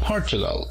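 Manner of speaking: "Portugal" in English, POR-chu-gal, falling away; stress on first syllable. Shown as a demonstration. Teacher models English "Portugal" for learners, stress on first syllable. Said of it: "se encuentra en la primera sílaba".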